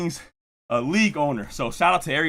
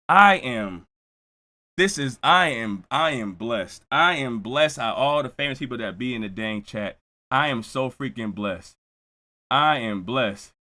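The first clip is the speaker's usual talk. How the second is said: humbled gratitude; 7 semitones lower than usual. Real, not acted. Streamer thanking his chat's famous people, humbled gratitude. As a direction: drop low and let the gratitude sink in.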